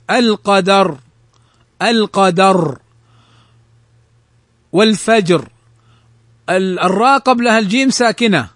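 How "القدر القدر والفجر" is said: thick, dark final ر in القدر and والفجر; careful model words with heavy closing ر in القدر and والفجر. Shown as a demonstration. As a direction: end القدر and والفجر on a full, emphatic ر. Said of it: "ففخمت".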